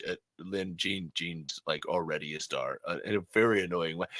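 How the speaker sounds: low, soft cartoon husband voice; weary husband. Husband telling wife no podcast in restaurant, low impression. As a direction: object low and quietly.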